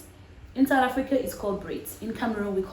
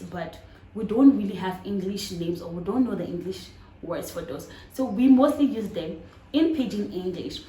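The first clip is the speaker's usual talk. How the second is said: ordinary delivery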